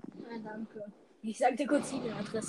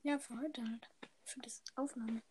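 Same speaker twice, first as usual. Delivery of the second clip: whisper; 12 dB quieter than usual. Real, not acted. Whispering during recording.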